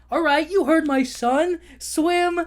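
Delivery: high, loud command to swim; rally the whole crowd of fish; rallying shout. Retold or imitated. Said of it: "go like".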